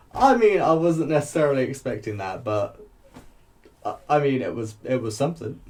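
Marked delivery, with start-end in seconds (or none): none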